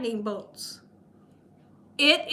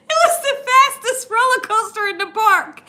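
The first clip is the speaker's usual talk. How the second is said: high, squealing through real laughter; helpless laughter, near tears; cracking up; 8 semitones higher, 4 dB louder than usual. Real, not acted. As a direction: laugh so hard the words come out high. Helpless laughter repeating the punchline.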